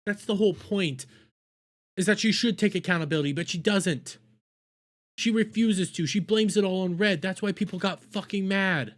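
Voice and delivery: worked up to near screaming; real exasperation. Real, not acted. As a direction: hammer the point in a high, frustrated rant. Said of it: "I have to scream loudly".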